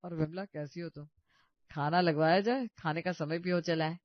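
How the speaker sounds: a relaxed elder host; elder brother-in-law. Acted. Elder man greets guests. (धीमी, बड़े-बुज़ुर्ग की आवाज़)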